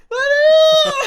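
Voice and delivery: very high, very loud shriek of a name; imitated startled scream. Storyteller imitates lost friend on seeing him, piercing scream. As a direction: scream it out in sudden recognition.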